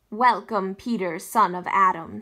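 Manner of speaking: low, loud and solemn lion king; a regal lion's deep welcome; deep, rich lion voice. A narrator's reading. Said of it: "His voice was deep and rich".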